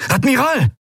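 high, urgent call. A narrator's reading. Crewman calling the admiral in alarm, high urgent call. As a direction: alarm in one word. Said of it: "rief Quailor".

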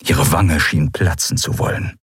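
plain reading voice

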